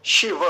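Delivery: शिव said "shi-va"; full final va. Shown as a demonstration. Teacher modelling for students, full va in शिव. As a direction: voice the last व of शिव completely. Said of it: "शिव नहीं बोलेंगे".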